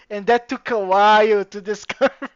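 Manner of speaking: laughing. Real, not acted.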